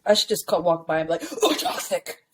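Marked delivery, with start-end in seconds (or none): 1.4-2.2 higher-pitched teasing aside; mock passing jab. Walking by the neighbor, pretend judgmental jab. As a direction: toss it out as a pretend dig.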